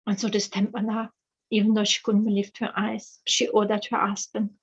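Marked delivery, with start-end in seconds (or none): none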